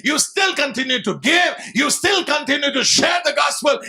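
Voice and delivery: slow, driving sermon cadence; full-force preaching. Real, not acted. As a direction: hammer out each exhortation to the congregation. (ಆವೇಶಭರಿತ ಉಪದೇಶ)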